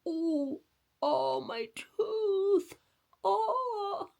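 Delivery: high, slow hammed-up wail of pain; mock crying over a tooth. Storyteller playing the boy, hammed-up crying over his tooth. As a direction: ham up a toothache whimper.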